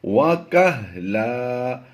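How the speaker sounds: وَكَهْلَا with the final alif drawn long, slow; recited with pause form. Shown as a demonstration. Teacher demonstrating pausal reading, slow and drawn. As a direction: say وَكَهْلَا slowly, stretching the last لَا. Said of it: "دو زبر کی تنوین کو ہم وقف میں ایک الف مدہ سے".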